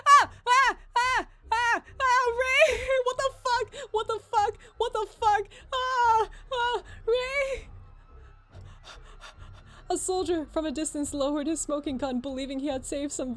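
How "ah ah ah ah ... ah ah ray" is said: panicked high screams, much louder; yelling for Ray, real fright; real screaming. Real, not acted. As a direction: shriek in genuine terror. Streamer screams at jump scare.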